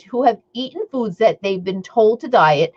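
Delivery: ordinary talk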